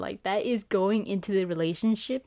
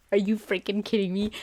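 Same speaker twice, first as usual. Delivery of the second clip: normal talking voice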